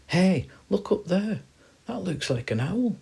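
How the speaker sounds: high guinea pig call, stretched out. Acted. Guinea pig spotting a bird overhead, calling out high. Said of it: "the guinea pig calls out".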